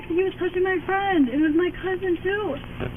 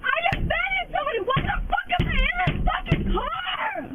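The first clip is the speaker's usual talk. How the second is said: real furious yelling, much higher; tantrum screaming; 7 semitones higher than usual. Real, not acted. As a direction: shout the protest from the back seat. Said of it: "Princess doing the tantrum".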